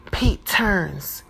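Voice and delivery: ordinary delivery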